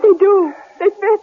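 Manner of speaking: high, eager relief; excited relief. Acted. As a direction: voice jumps up with relief. Relief at bullets fitting.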